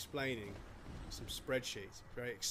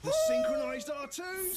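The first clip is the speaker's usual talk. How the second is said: high ecstatic shriek; shrieking jackpot winner; slower than usual. Real, not acted. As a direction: shriek it out like you hit the jackpot. Gaming win, shrieking.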